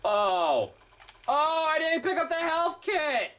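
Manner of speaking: high, drawn-out cry of dismay; anguished wail. Real, not acted. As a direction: wail slowly at your own blunder. Dismayed at missed health kit.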